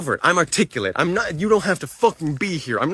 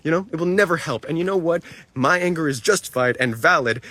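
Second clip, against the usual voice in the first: usual speaking voice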